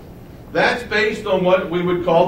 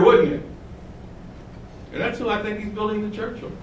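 normal talking voice

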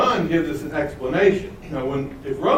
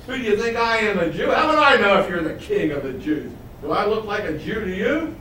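higher, with a mocking laugh; frustrated sarcasm; 5 semitones higher than usual. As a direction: laugh at the insult, then scoff. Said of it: "that frustration in his voice".

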